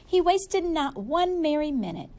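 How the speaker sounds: ordinary delivery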